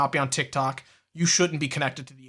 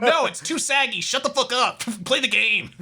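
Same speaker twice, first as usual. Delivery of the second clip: higher-pitched mock-indignant heckle; mock heckling; 6 semitones higher than usual. Chat yelling back at the VTuber, mock indignant. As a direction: playfully tell off the streamer.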